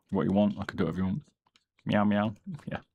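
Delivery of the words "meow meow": casual imitated meows at the cat; unforced meowing, no pitch lift. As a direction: meow in your own speaking pitch.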